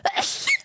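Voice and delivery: sudden high, explosive sneeze; real sneeze. Real, not acted. Streamer interrupted mid-stream by a sneeze, high and sudden. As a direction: let a sneeze burst out. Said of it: "going up when I sneeze".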